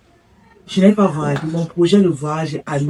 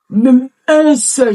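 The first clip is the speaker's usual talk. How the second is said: higher, slow and louder, leaning on un; emphatic complaint; 7 semitones higher, 4 dB louder, much slower than usual. Acted. Woman complaining to the pastor that no project worked, emphatic outrage. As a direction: stretch out Même un seul in outrage.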